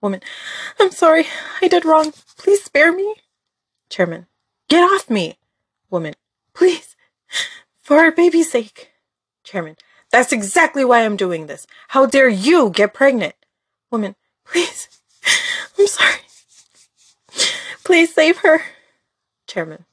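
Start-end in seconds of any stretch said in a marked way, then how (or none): 0.8-3.1 high, frantic plea for mercy; terrified begging. Acted. 4.7-5.4 angry snap. Acted. Chairman shoving her away. 6.5-8.9 high, frantic plea for mercy; terrified begging. Acted. 10.1-13.4 menacing anger. Acted. Chairman threatening pregnant maid. 14.4-18.6 high, frantic plea for mercy; terrified begging. Acted.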